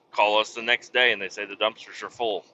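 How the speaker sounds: talking through hiccups; hiccuping between words. Real, not acted. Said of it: "I'm hiccuping".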